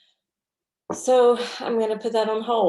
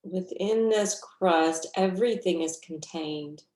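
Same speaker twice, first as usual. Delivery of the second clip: guided meditation. Real, not acted. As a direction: guide them gently through the breath, unhurried.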